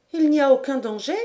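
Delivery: anxious question over the storm; worried question. A narrator's reading. Lady Helena asks the captain during a lull, worried.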